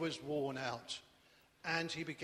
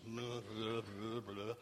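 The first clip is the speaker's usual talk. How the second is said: low muttered blah blah, lower and softer; grumbling; 7 semitones lower, 6 dB quieter, slower than usual. Acted. Disciples grumbling as they search the crowd, low muttered grumble. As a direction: grumble it under your breath.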